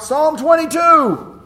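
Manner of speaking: a high, loud, slow call across a crowd; shouting out. Shown as a demonstration. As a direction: shout it out like announcing a number. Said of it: "shouting out".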